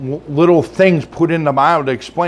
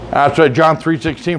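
usual speaking voice

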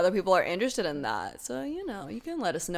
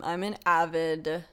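ordinary delivery

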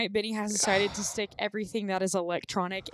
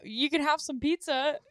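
Claptrap; 5 semitones higher than usual. Retold or imitated. Imitating a robot host at his empty party, high and sad. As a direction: offer it sadly in a raised little voice. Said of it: "And he's like".

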